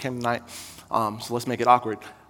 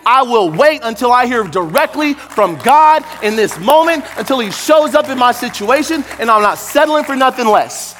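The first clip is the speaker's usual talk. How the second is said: full-force preaching; 10 semitones higher, 7 dB louder than usual. Acted. Preacher calling the congregation to faith, loud and high. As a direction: preach it hard to the crowd.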